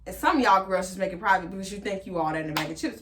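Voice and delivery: ordinary talk, nothing marked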